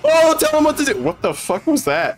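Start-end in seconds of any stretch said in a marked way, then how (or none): 0.0-1.0 high-pitched mocking echo; mock urgency. Streamer echoing a trailer character's plea, mock urgency.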